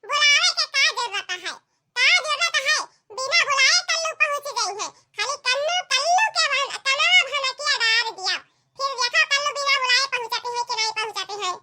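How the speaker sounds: put-on high little kid, fast and cheeky; child voice. Child Kallu bragging he'll come uninvited. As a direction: brag like a cocky small boy.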